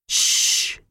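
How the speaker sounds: sharp voiceless shush; hissed shush. A narrator's reading. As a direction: hiss them into silence. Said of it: "he hissed".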